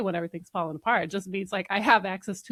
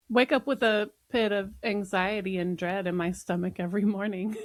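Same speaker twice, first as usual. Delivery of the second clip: normal talking voice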